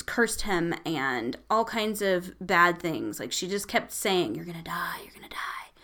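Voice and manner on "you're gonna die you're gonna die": breathy ghostly whisper of a threat; a whispered curse from the witch; spooky whisper. Retold or imitated. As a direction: hiss it like a menacing spirit. Ghost's threat retold in story.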